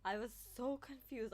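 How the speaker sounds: ordinary delivery, nothing marked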